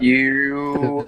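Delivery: choppy robotic connection; glitchy robotic audio. Real, not acted. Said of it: "you sound like a robot".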